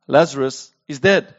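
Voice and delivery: low, matter-of-fact pronouncement; plain statement. A narrator's reading. Jesus telling disciples the truth, stated plainly and low. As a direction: say it plainly, dropping the pitch. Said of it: "Jesus said to them plainly".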